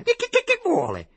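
tripping over her own rage; angry stammer. A narrator's reading. Lady sputters angrily at the servant. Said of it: "domandò con rabbia".

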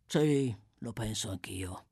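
low, slow grumble; Sykes grumbling. A narrator's reading. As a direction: mumble it low and grudgingly. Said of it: "borbottò Sykes".

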